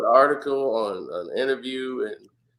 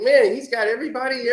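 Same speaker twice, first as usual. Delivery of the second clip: amazed; 11 semitones higher, 4 dB louder, faster than usual. Retold or imitated. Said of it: "I was like".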